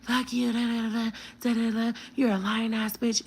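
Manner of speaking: mock high-pitched tirade; mocking cussing out. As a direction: imitate someone going off in a high chant. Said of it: "She's cussing me out".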